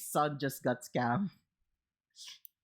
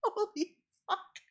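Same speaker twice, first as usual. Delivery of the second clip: laughing; 5 dB quieter than usual. Real, not acted. Bursts out laughing after story.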